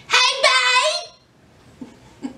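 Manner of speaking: high, happy hello to her man; excited greeting. Retold or imitated. Girlfriend greeting happily.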